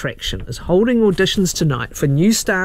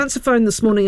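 ordinary talk, nothing marked

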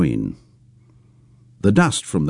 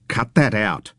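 sharp; 3 semitones higher than usual. Acted. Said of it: "Pam spoke sharply".

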